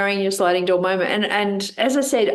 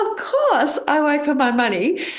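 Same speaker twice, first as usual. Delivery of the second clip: higher, mildly scornful retort; scoffing dismissal; 6 semitones higher than usual. Retold or imitated. Recalling the book's question, she scoffs back higher. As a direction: brush the idea off as obvious, pitched up. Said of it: "And I was like".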